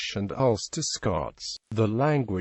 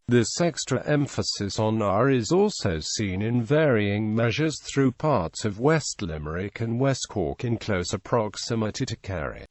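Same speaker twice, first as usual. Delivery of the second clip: ordinary talk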